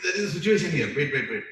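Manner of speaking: urgent charioteer calming; urgent pleading. Retold or imitated. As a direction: hold him back urgently. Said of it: "He's like".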